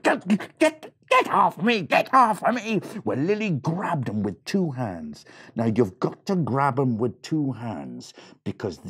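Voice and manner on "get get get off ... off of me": screaming leprechaun. Acted. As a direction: squeal like a caught little creature. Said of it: "the leprechaun began to scream".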